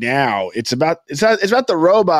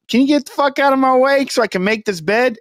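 high-pitched snippy robot; a robot shooing the maid out of the room; mock robot voice; 7 semitones higher than usual. Friend mocking the robot maids, high snippy voice. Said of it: "blinking little robots like".

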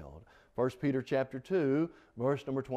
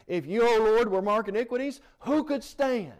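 preaching at full force; 8 semitones higher, 7 dB louder than usual. Acted. Climax of quoted psalm.

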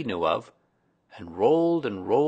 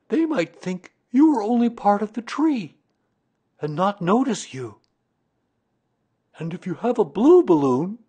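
higher, louder, deliberate bear voice; Pooh, earnest explaining; 10 semitones higher, 6 dB louder than usual. A narrator's reading. Pooh telling Christopher Robin his balloon plan, earnest explaining. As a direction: explain a pet plan with emphatic key words.

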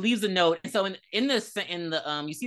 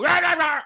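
raised, high nonsense hollering; mock yelling; 11 semitones higher, 8 dB louder than usual. Fed-up podcaster mimicking men who scream and holler, mock yelling. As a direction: imitate pointless hollering. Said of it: "that yelling and jumping down shit".

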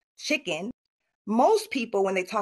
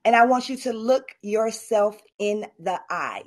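ordinary talk

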